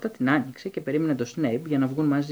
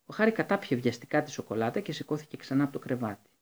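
ordinary delivery, nothing marked

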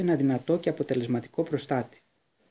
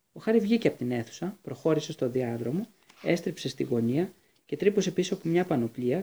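ordinary delivery

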